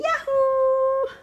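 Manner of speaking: drawn-out high yahoo; excited cheer. Real, not acted. Cheering kids to eat.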